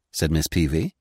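usual speaking voice

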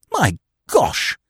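high, amazed exclamation; electrified cry. A narrator's reading. Freddy reacts to Eve's news, electrified cry. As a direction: cry out as if jolted.

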